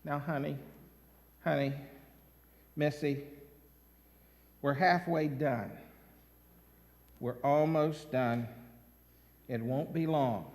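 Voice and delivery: low, soft, patient mom; calm the child gently; mother hushing her toddler softly; soothing mother. Retold or imitated. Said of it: "the mother says".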